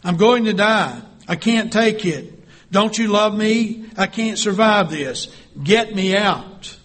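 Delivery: high, loud panicked pleas; screaming. Acted. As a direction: beg to be pulled from the fire. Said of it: "I began to scream".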